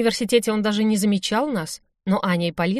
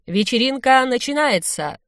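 slow, high party host's call; drawn-out raised-pitch proclamation; microphone announcement; 5 semitones higher, 3 dB louder than usual. A narrator's reading. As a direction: announce it to the whole hall, stretched out. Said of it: "закричал Вадим в микрофон".

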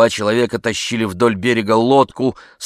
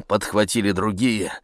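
ordinary delivery

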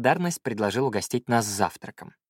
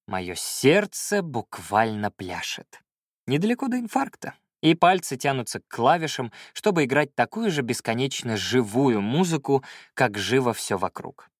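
plain reading voice

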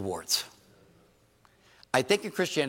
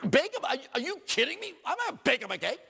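much higher, incredulous mock outrage; indignant churchgoer; 14 semitones higher than usual. Preacher voicing a listener refusing to bake a cake, high and indignant. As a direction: squeak it out like you can't believe it. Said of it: "you say".

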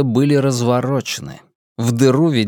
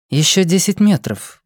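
a hint of a cold, dutiful woman; cold obedient reply; 7 semitones higher than usual. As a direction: answer dutifully but coldly. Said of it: "послушно, но холодно ответила она". (послушно, но холодно)

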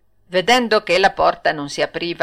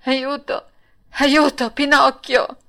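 higher, frightened call for help; panicked cry for help; 6 semitones higher than usual. Acted. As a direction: cry out to your friend in alarm. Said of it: "barcollando e cambiando colore".

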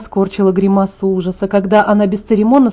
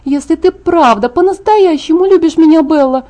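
insistent plea; 7 semitones higher, 3 dB louder than usual. Acted. Alice presses her emotional plea.

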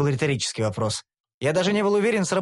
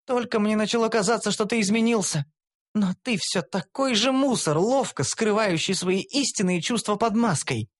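higher, contemptuous elf voice; elf hissing through teeth; 7 semitones higher than usual. A narrator's reading. Elf scorning the hero on the floor, contemptuous. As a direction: spit the words out with disdain. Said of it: "процедила Карамелька".

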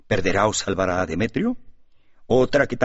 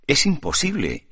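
a higher, startled exclamation; exclaiming; 7 semitones higher than usual. A narrator's reading. Alejo refuses to believe the news, exclaiming. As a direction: burst out in disbelief. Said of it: "exclamó Alejo".

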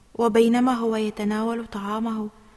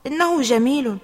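louder mock compliment; 3 semitones higher, 7 dB louder than usual. A narrator's reading. Faisal answering Ali about his haircut, mocking. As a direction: praise with a touch of ridicule. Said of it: "أجاب فيصل باستهزاء".

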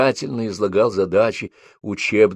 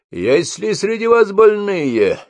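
shouting drill sergeant; 11 semitones higher, 4 dB louder, slower than usual. A narrator's reading. Sergeant questions the ranks.

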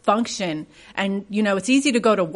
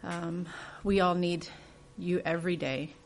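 soft, hushed closing prayer; a gentle, quiet church prayer; 3 semitones lower, 12 dB quieter than usual. Real, not acted. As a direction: pray quietly to God, unhurried. Closing prayer before the sermon.

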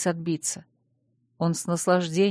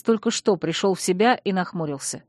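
ordinary delivery

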